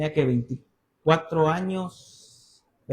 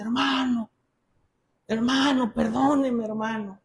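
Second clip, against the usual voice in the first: higher, lightly voiced elderly churchwoman; old woman apologizing; 4 semitones higher, slower than usual. Old churchwoman begging the young preacher's pardon, pleading. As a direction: plead gently as an imitated old lady. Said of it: "una viejita de".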